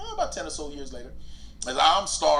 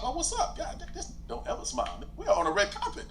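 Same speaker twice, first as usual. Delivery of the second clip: laughing; 9 semitones higher, 3 dB quieter than usual. Retold or imitated. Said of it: "retelling his reply, laughing".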